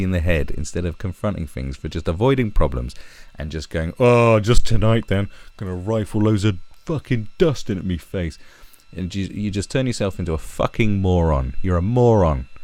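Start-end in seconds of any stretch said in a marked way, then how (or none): 4.0-8.4 a lightly put-on self-excusing voice; mildly mocking impression; mock excuse voice. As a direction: toss it off like a careless user.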